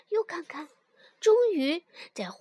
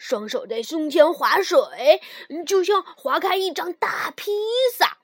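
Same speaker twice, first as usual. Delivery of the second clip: character voice; 9 dB louder than usual. Acted. Frog teaches swimming, character voice.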